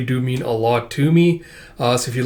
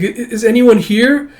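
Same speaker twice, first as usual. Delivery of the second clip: high, louder, stammered call; anxious calling out; 9 semitones higher, 6 dB louder than usual. Acted. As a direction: call into the dark as a lost child. Lost child calls out.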